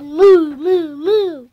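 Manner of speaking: loud playful cow moo. Pretend. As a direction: bellow the moos like a cow. Duck mooing to trick thieves.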